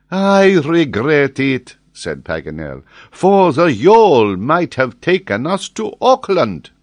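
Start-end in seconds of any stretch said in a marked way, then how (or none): 0.1-1.8 higher, slower, louder, in a different voice; Paganel's voice. A narrator's reading. Paganel regrets the boat to his companions, voiced high and slow. Paganel laments the lost yawl. 3.1-6.7 higher, slower, louder, in a different voice; Paganel's voice. A narrator's reading. Paganel regrets the boat to his companions, voiced high and slow. Paganel laments the lost yawl.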